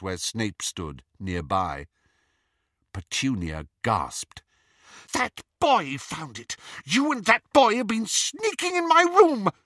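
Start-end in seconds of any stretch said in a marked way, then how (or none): 5.1-9.5 angry accusation. A narrator's reading. Petunia accusing Lily of sneaking in her room, loud and angry. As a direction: shout the accusation.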